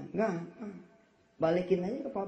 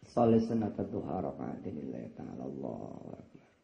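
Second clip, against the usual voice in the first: low, soft prayer opening; murmured prayer intention; 13 semitones lower, 4 dB quieter than usual. Acted. Worshipper opening the duha prayer, low and murmured.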